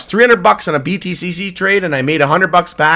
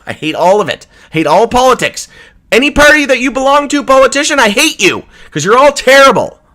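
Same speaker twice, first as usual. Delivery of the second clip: louder, higher furious outburst; real anger; 8 semitones higher, 5 dB louder than usual. Real, not acted. Streamer yelling at every politician, higher and furious. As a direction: let the hatred of politicians boil over. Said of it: "I've never been more fucking mad than today".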